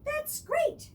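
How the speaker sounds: high, delighted squeal; bursting with glee; gleeful cry. Acted. Mr. Willoughby cheers the cut tree top, high gleeful cry. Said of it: "Mister Willoughby cried with glee".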